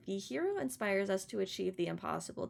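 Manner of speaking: usual speaking voice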